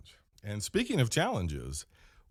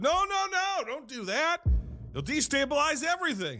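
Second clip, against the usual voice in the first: much higher panicked voice, rushed no-no-no; panicked corporate. Retold or imitated. Corporate on the phone stopping the manager, panicked high. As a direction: squeak out the alarm from corporate. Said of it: "They said".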